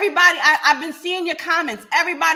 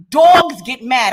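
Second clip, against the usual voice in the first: very slow, louder, every word hit; slow loud emphasis; 9 dB louder, much slower than usual. Real, not acted. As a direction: spell it out with force. Rebuking commenter, emphatic.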